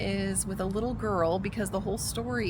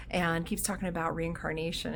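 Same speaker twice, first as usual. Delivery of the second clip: ordinary talk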